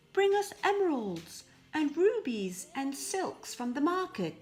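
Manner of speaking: the sisters ordering their father about; demanding sisters. Acted. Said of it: "demanded Sophie and Marie".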